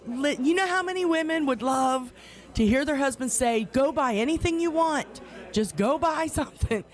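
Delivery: high, eager mock husband pep talk; hammed-up cheerful husband pleading; playfully imitating an encouraging husband. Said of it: "he was trying to be so nice and encouraging".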